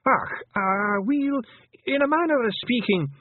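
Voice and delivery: a cheeky pixie squirming for an excuse; squeaky, guilty Feegle stalling; flustered Feegle. Acted. Rob Anybody caught out.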